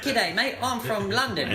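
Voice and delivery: put-on high, laughing send-up of an Australian accent; hammed-up Australian greeting, pitched well up; mock Australian accent. Friend mocks the Australian host with a jokey Australian accent. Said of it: "Your Australian accent is worse".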